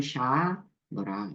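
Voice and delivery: two-piece reading of "샤, 뭐라"; separated pronunciation. Shown as a demonstration. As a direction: keep "샤" and "뭐라" apart. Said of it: "분리해서 발음하세요".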